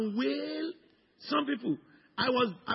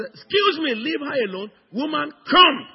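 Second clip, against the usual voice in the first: loud, higher, commanding priest; firm command; 7 semitones higher, 9 dB louder than usual. Retold or imitated. Priest orders the wailing woman away, loud and firm. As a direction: order her back sharply. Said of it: "I pushed the first one".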